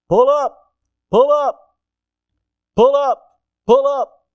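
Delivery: high, loud automated cockpit alarm; urgent imitation of a plane's warning voice; shrill computer warning voice. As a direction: shout it like a synthesized warning system. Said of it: "a shrill computer-synthesized voice".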